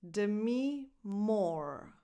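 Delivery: "Demi Moore" drawn out slowly, word by word; slow teacher's model of "Demi Moore"; slow demonstration. Shown as a demonstration. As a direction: say "Demi Moore" very slowly, with a gap between names. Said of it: "This last name is pronounced".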